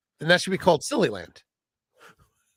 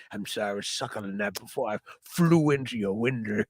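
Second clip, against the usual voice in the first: mock old man; 6 semitones lower, 5 dB quieter than usual. Voicing old man ghost.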